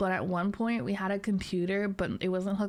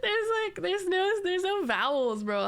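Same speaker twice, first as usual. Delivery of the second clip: high amused disbelief; 10 semitones higher than usual. Real, not acted. Laughing disbelief at username.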